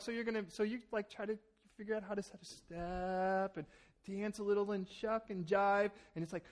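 usual speaking voice

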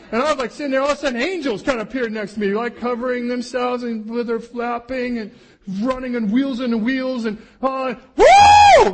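loud, high, driving preacher voice; full-force preaching. Acted. Preacher rousing the congregation, loud and high. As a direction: preach it out big and loud to the room.

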